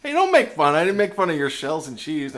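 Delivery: high, loud mock outrage; loud protest. Real, not acted. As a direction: squeal a playful protest. Protesting being mocked.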